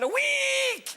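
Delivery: squeaky reply. Acted. Comic answering the doctor, high yelp. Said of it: "I said".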